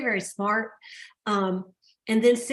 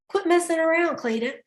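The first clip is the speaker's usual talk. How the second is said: raised-pitch, mock no-nonsense telling-off; matter-of-fact scolding; 7 semitones higher than usual. Blunt mentor tells her to stop stalling, mock scolding. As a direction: playfully imitate a blunt mentor setting someone straight. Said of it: "she's a real matter of fact girl".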